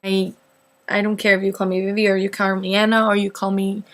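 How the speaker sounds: normal talking voice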